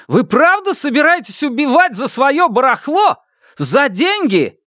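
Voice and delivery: high, incredulous shouted questions; outraged. Acted. Фан Му confronting villagers ready to kill, outraged. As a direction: demand an answer in outrage. Roaring outrage at mob continues.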